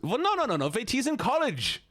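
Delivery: high-pitched, indignant mock German accent; alarmed German-accented objection. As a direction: protest in a squawking put-on German accent.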